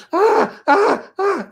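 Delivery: very high frantic yelps; hammed-up helpless screaming; mock panic scream. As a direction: shriek like someone stuck on a live wire. Acting paralyzed electrocuted panic.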